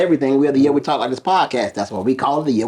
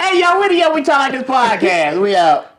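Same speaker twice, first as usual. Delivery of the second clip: hyped sign-off; 9 semitones higher than usual. Real, not acted.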